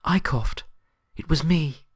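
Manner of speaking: high, steady confession from a timid pony; Fluttershy confessing to her friends with sudden confidence; suddenly confident. Acted. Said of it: "Fluttershy's voice, suddenly confident".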